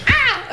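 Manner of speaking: high mock war cry; odd battle cry. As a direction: yell it like a silly battle shout. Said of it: "ein ganz komischer Kampfschrei".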